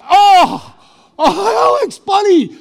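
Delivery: put-on high loud cry of pain at the child. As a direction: yelp the child's name as it hurts. Grandson bites him, cries out.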